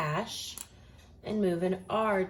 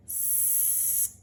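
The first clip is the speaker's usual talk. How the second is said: whispered word; 13 dB quieter than usual. Shown as a demonstration. Teacher whispers the word to find its first sound. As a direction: whisper the word scars to yourself. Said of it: "I'm looking for the first sound".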